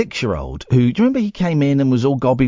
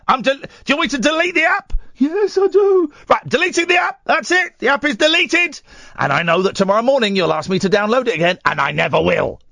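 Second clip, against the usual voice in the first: higher, fast, hammed-up stern parent deleting the app; mock-firm dad voice, raised and rushed; 9 semitones higher than usual. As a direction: play the fed-up dad laying down the law. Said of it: "it was that kind of vibe".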